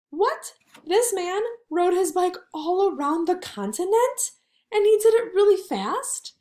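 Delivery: mock amazement. Teacher showing pupils amazement at the bike record, mock astonished. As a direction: act amazed for the kids.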